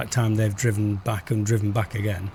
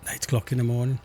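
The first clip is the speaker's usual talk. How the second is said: ordinary talk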